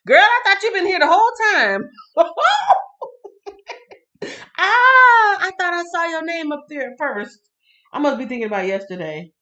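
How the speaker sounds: high, loud delighted welcome; excited greeting. Real, not acted. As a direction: squeal with joy at a friend arriving. Greeting a latecomer with laughter.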